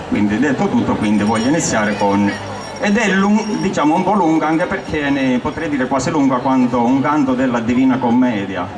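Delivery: ordinary delivery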